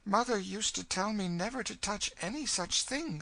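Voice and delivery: put-on high, earnest girl's voice; innocent young girl. Girl recalling her mother's warning, earnest protest. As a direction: protest earnestly, pitched well up.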